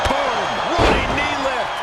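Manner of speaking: excited call. Real, not acted. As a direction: sell the hit to the crowd. Calling a big strike.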